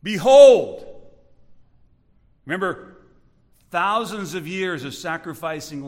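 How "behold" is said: loud, drawn-out proclamation; calling out. Acted. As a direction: call it out like a herald. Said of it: "John the Baptist calls out".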